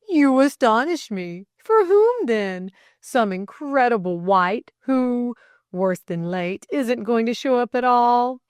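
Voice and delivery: animated, loud mock astonishment; teasing. A narrator's reading. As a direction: tease with exaggerated amazement. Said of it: "teased Delcote".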